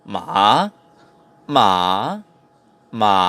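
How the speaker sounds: MA dipping low then rising back up; "ma" with a low falling-rising dip; third tone. Shown as a demonstration. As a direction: drop "ma" down, then lift it. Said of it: "third tone is the falling rising tone".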